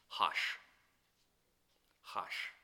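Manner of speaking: a breathy whispered hush. Retold or imitated. Preacher voices the crowd hushing Bartimaeus, whispered. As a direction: shush him under your breath.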